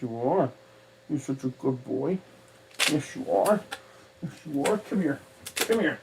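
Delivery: talking to a dog. Real, not acted. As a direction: coo at the dog.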